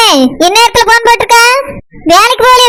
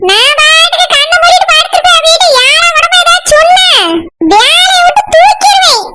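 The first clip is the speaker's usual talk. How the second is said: higher-pitched nagging mother voice; higher, shouting old mother; scolding mother; 5 semitones higher than usual. Acted. Mother figure threatening.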